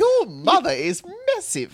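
high, slow put-on voice for the insult; mock yo mama voice. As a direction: ham up the yo mama joke slowly and high.

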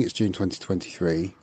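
sheepish repeat. Real, not acted. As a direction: mumble the date again, embarrassed. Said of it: "I don't know why I'm telling you".

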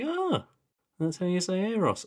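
high-pitched mock "aha" moment; retold sudden realisation. Film fan recounting learning a name at the cinema, high realisation. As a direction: act out the penny dropping, voice leaping high.

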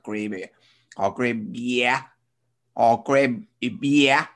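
put-on slow, broad Sydney accent, drawn out; harsh Sydney accent. Teaching his friend the bar order in a slow broad Sydney accent. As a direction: stretch each word like a Sydney barfly. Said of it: "the really harsh Sydney accent".

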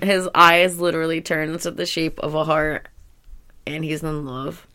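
ordinary talk

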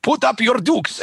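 high, hammed-up old-timey boxer; playful skit bravado; mock challenge. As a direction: square up like a cartoon prizefighter. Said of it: "And I'm like".

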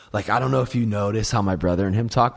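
ordinary talk

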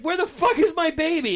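high panicked demand; mother searching for her baby in panic; frantic. Retold or imitated. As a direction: demand it in alarm. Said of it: "I'm just like".

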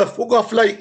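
high, rowdy mock heckle; jeering crowd. People at the horrible gig, mock jeering. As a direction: groan it out like a hostile punter. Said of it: "full of people going".

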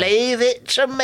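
high, drawn-out assurance; hammed-up high sing-song promise; mock eager owner. Imitating the owner, first take.

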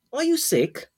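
put-on high worried little boy; small child voice. Child asks grandfather.

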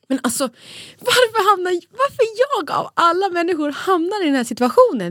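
imitating her own desperate plea, high and strained; upset retold outburst. Talking back to a rude caseworker, high and loud in despair. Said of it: "Jag bara".

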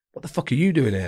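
put-on startled shout from the old sergeant; surprised exclaim. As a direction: blurt it out, caught off guard. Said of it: "he was kind of back turned and was like".

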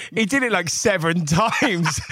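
high, loud, incredulous through laughter; laughing. Real, not acted. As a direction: break up laughing while telling it. Cracking up at repeated prank.

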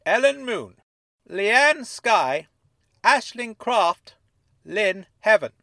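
thoughtfully. Acted. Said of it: "He repeated them thoughtfully".

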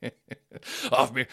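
ordinary delivery

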